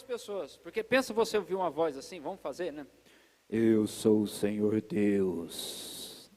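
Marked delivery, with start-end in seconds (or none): 3.5-6.3 very low, very slow booming God voice; voz grave de Deus. Pretend. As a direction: boom it out like a movie God, slow and deep. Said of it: "até parece que Deus fala com essa voz assim". (voz divina grave e lenta, de locutor)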